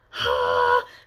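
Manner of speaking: sharp low gasp of delight; a real gasp of surprise. Real, not acted. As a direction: gasp at the signed copy. Unboxing a signed book.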